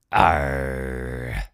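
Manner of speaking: low pirate growl; pirate arr. Pretend. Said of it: "Talk Like a Pirate Day".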